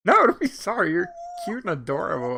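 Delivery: ordinary talk, nothing marked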